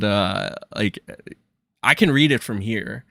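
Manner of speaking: ordinary delivery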